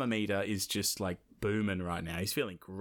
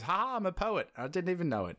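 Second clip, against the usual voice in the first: high, pleased with himself; smug rhyming gloat; 7 semitones higher, faster than usual. Acted. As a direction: gloat over a rhyme. [name] gloats over rhyme.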